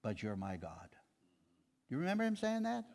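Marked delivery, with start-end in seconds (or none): 0.0-0.9 hushed, low voice, falling softer; quietly overwhelmed. Retold or imitated. Thomas answering the risen Jesus, overwhelmed and hushed. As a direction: imitate awe that has sunk almost to a murmur. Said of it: "Thomas was so overwhelmed".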